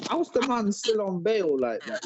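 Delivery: retold disbelief, pitch jumping up; incredulous. Telling the girl on the phone he's baffled, incredulous. Retelling own baffled reaction to caller.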